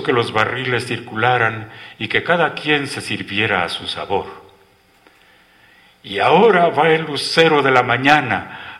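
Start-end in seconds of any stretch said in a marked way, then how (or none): 6.0-8.8 raised, louder showman's announcement; announcing. A narrator's reading. Marimba player introduces his next tune to the dancers, announcing. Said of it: "anunció volviendo a su marimba".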